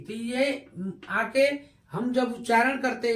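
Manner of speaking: ordinary talk, nothing marked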